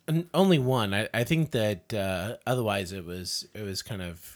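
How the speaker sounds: ordinary delivery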